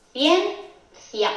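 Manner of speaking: "Ciencia" broken into two syllables, cien, cia; división en sílabas. Shown as a demonstration. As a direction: say "Ciencia" syllable by syllable with a pause between. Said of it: "lo haríamos así". ("Ciencia" separando las sílabas: cien, cia)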